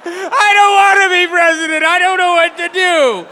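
high, loud blubbering whine; hammed-up loud sobbing, high and helpless; mock crying president. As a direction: wail it like a panicked man crying in a motel corner. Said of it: "just crying in the corner, going".

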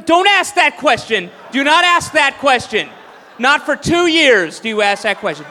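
loud angry shouting at a reporter; mock furious handler. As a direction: bark at the reporter to back off. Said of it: "we're like".

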